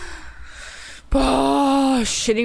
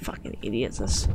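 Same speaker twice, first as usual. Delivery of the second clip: whispered insult; 9 dB quieter than usual. Real, not acted. Muttered insult.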